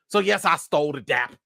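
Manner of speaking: ordinary talk, nothing marked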